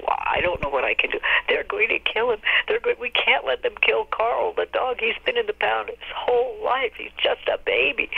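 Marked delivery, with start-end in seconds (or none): 1.5-8.1 high, pleading mock sob; imitating a weepy plea. Friend begging to save the dog, high weepy plea. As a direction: plead for the dog, pitched up and teary. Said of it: "my friend called me just sobbing".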